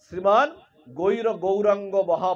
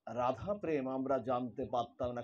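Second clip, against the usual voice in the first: রাধা প্রেম আমরা জানতে পারতাম না said lower and much softer; soft restatement; 8 semitones lower, 12 dB quieter than usual. Shown as a demonstration. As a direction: drop back to a quiet, settled close. Restated softly after emphasis. (নরম নিচু গলায় শান্ত পুনরাবৃত্তি)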